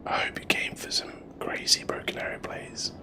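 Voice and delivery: soft whisper into the mic. Real, not acted. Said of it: "I'll have to just whisper into the mic".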